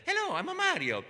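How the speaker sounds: high, bright Italian Mario voice; happy friendly Italian plumber, way up high. Retold or imitated. Mario improvising a pizza game at the audition, high Italian voice. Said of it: "nice Italian guy, talk like this".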